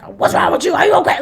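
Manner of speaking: lightly voiced agitated father; imitating a father snapping at his daughter; mock outburst. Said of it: "He's like".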